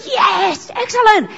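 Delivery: high, stretched-out cheer; enthusiastic praise. Acted. You acknowledging someone's ta-da, enthusiastic praise. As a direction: applaud them with emphatic delight. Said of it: "you've got to go".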